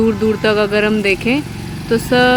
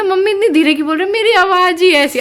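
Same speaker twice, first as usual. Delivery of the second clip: mocking mom; 7 semitones higher, 4 dB louder, faster than usual. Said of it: "और जब मैं कहूँगी ना".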